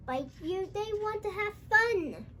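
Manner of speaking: ordinary delivery, nothing marked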